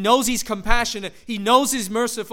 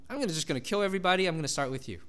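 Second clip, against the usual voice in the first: brisk offhand God; 2 semitones lower, 6 dB quieter, much faster than usual. Pretend. Voices God casually.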